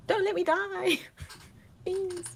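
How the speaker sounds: high, tearful plea; half-crying plea to the goddess; pleading prayer. Pretend. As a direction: beg not to die. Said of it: "pray to my God".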